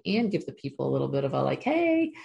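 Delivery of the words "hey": much higher, bright call of hey; whale's high happy hello; cheery greeting. Pretend. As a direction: sing out a high friendly hey.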